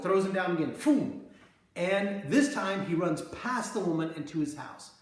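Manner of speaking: ordinary delivery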